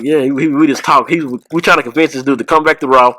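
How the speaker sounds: ordinary talk, nothing marked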